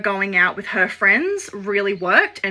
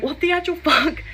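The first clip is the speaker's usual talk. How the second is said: high, stunned disbelief; acting out her own shocked reaction, much higher; 8 semitones higher than usual. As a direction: voice jumps up in outraged shock. Shocked reply to a rude text.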